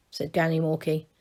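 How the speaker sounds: ordinary delivery, nothing marked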